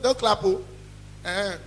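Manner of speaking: ordinary talk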